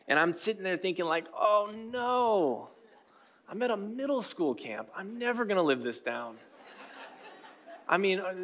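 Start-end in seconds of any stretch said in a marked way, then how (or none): 1.3-6.4 high, dismayed inner voice; mock dread. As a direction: play up the embarrassed dread of a doomed reputation. Inner dread after crying at camp.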